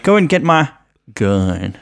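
mock American twang on the last word. Mimicking an actor's slipping American accent, American twang. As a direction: put an American twang on the end. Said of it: "a bit of an American twang".

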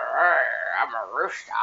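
low, hammed-up rooster voice; put-on low voice, sending him up; mock rooster-man. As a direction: imitate the brother as a dopey low rooster. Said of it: "he's a rooster".

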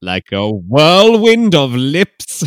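slow, loud, dramatic; mock swoon. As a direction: ham it up like a romance novel. Mock romantic flourish.

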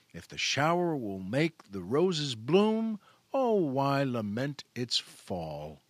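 slow, measured poetry reading; a host reads Charlotte Bronte's poem to listeners; poem recitation. Acted. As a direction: savor each line like a fond recital.

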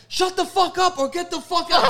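high, loud shout with a laugh; mock crowd shout. The cinema crowd yelling at the girls, high and loud. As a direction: yell it with the crowd. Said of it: "they're like".

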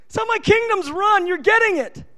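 God inviting a believer to ask, delighted and high. Acted. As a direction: gush with joy at the asking. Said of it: "He goes".

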